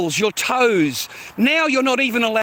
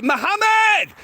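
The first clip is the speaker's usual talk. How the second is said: a loud, high shout of a name down the street; calling out; 12 semitones higher, 3 dB louder, slower than usual. Retold or imitated. Speaker calling a name out the front door, loud high shout. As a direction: yell the name out the front door so everyone turns. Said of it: "walk out of your door and say".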